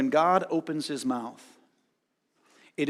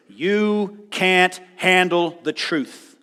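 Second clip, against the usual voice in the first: stern God; 4 semitones higher, 7 dB louder, much slower than usual. Retold or imitated. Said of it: "what God says to him is".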